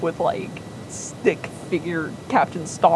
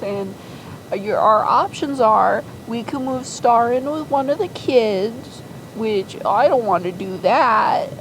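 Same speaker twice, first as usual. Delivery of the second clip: put-on higher, sugary little-girl voice pleading with dad; sweet daughter voice; 5 semitones higher than usual. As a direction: coax daddy in a syrupy high voice. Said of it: "she goes".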